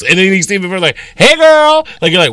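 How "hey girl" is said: put-on high, loud, bubbly hey girl; high casual greeting. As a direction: switch to a high chummy greeting. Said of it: "you totally put on the front".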